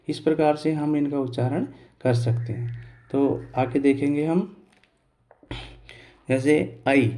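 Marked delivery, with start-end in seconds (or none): none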